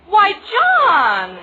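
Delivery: loud, high scandalized gasp of a name; mock-scandalized at his cheek; shocked exclamation. Fiancée scandalized by John's baby remark, loud and high.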